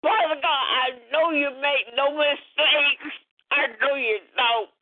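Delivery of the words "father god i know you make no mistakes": ordinary delivery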